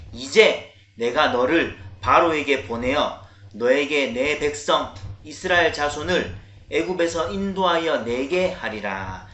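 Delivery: booming, measured verse recitation; loud scripture reading. Real, not acted. Pastor reading the next verse with children, loud resounding voice. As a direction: proclaim the verse loudly for all to follow. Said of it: "큰 목소리로 읽어봅니다".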